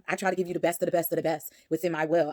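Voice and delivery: ordinary delivery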